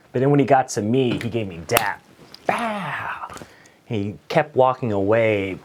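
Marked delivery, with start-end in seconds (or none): 2.5-3.3 whispered dap sound effect. Retold or imitated.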